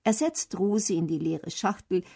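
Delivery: ordinary delivery, nothing marked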